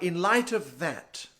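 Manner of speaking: very slow, stiff posh British; posh British bank manager. Acted. Bank manager doubting a mortgage applicant, posh British condescension. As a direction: draw the words out in snooty British, fairly soft. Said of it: "Then he goes like".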